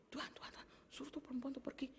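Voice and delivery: soft whispered grumble; complaining whisper. Acted. Disciples asking Jesus to send her away, whispering.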